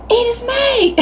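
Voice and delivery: high, sharp yell of a name; angry mom yelling. Retold or imitated. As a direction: call the name like a furious mom. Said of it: "my mom screaming my name".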